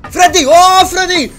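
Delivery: high, loud joyful call; excited calling. Real, not acted. Gamer greeting the robot Freddy, joyful loud call. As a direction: shout across the room like greeting a lost friend.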